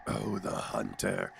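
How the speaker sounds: slow, low, quiet character voice; hushed, drawn-out low hunter voice; the hunter's voice. Shown as a demonstration. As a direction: draw it out low and soft as the hunter. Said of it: "Even the hunter came".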